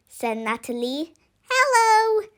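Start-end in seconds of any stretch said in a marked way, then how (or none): 1.5-2.3 high squeaky little monster voice; a tiny creature piping up a greeting; lightly voiced tiny monster. A narrator's reading. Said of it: "said the tiny monster".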